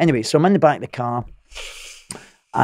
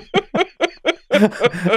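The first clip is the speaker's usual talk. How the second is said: a quick high giggle; high laugh; 12 semitones higher than usual. Real, not acted. Man mocking his own hopes with a high laugh. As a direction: laugh at the absurd hope.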